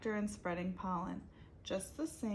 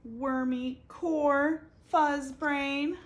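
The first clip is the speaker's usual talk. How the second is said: louder, slow taunting fuzz brain; insulting; 5 semitones higher, 9 dB louder, slower than usual. Acted. Abel hurling insults back at Beatrice, loud and slow. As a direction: drag out the insult to sting.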